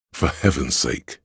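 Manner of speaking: usual speaking voice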